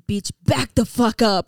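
snapping at someone to back off; angry. Retold or imitated. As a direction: tell her off, annoyed. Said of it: "I was so mad".

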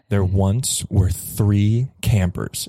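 slow, low ominous narration; campfire storytelling. Acted. Boyfriend telling friends a scary campfire story, ominous. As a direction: set up a scary tale, measured and low.

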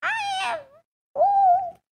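voice changer. Pretend. Voice changer old voice.

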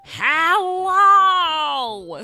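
bright shouted hello, high; loud sing-song greeting. Retold or imitated. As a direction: belt out a cheery hello. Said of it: "hearing a woman go".

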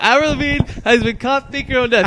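high, loud mock news bulletin; news anchor voice. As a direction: announce it like breaking news. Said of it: "Imagine the news came out".

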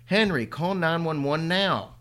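urgent command. Acted. Mother ordering her son to call for help, slow and loud. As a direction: spell out each word urgently.

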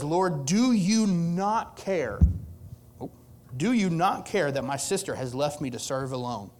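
drawn-out, loud plea on the opening; frustrated Martha. Acted. Martha appeals to Jesus about her sister, frustrated. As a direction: complain in exasperation, leaning hard on the words. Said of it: "She's almost a little frustrated with God".

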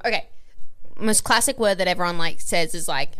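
normal talking voice

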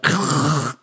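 exaggerated guttural German scrape; mock harsh German ch noise; fake German accent. Shown as a demonstration. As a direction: rasp the throat like a movie German. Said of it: "That's a fake German accent".

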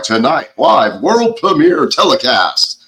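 slow, grand TV announcer delivery; drawn-out mock telecast introduction. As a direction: imitate a broadcast announcer billing a show. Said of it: "the announcer's like".

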